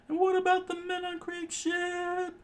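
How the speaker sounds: very high, strained falsetto question; high character voice. A narrator's reading. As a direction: squeak it out in falsetto. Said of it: "Sanji said".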